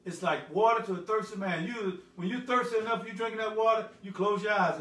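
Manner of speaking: big, emphatic pulpit voice; full-force preaching. Acted. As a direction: preach it out to the congregation.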